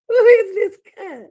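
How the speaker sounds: high, loud shouted question; incredulous shout. Real, not acted. Shouting at the screen in disbelief. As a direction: belt it out in disbelief. Repeats question, much louder.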